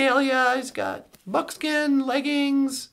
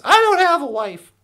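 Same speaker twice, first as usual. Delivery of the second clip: sudden loud, higher outburst; acted indignant denial; 4 semitones higher, 9 dB louder than usual. Policeman answering riders who asked about his wife, indignant. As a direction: shut the question down loudly. Cop snaps back at wife question.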